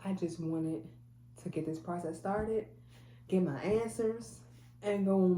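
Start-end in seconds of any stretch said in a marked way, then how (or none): none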